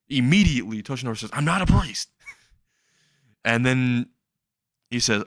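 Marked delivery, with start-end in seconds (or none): 1.3-2.1 high-pitched mock protest; indignant denial. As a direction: protest in a raised, offended pitch. Acted indignant denial of accusation.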